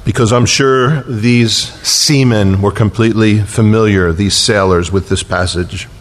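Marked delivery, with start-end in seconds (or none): none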